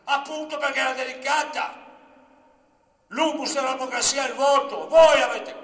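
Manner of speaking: loud, high indignant shout; indignant retort. Retold or imitated. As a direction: fire back at full voice, outraged. Indignant retort about the vote. (replica indignata, gridata)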